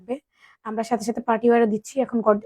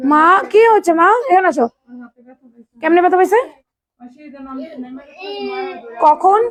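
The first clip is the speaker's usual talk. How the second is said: higher, louder worried questions; alarmed mother; 5 semitones higher, 3 dB louder than usual. Real, not acted. Mother alarmed at her hurt daughter, asks what happened.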